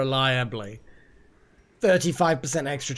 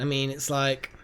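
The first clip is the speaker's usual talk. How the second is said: ordinary delivery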